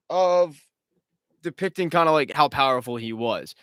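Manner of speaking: usual speaking voice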